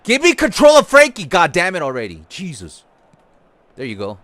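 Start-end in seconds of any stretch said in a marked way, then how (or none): 0.0-2.2 much higher, loud angry yell; frustrated shout. Real, not acted. Yelling at the game.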